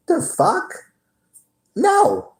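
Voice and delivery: mock-outraged refusal; incredulous. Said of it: "And I'm like".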